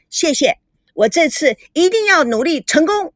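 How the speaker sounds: a hint of eager delight; gladly promising, bright and high; happy. A narrator's reading. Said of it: "吼吼高兴地说".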